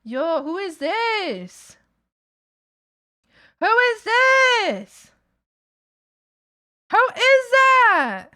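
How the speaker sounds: high, slow, loud drawn-out who is this; excited. Real, not acted. Streamer gushes over a viewer's new character drawing, high excited drawl.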